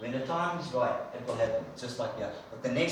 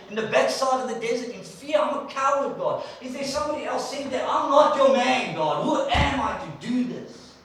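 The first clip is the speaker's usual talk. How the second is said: self-pitying complaint; 6 semitones higher, 3 dB louder than usual. Retold or imitated. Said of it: "what he's saying".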